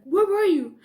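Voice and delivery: loud, sharp question; a father's loud where were you; angry demand. Retold or imitated. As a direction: demand an answer from your daughter. Dad demanding answers.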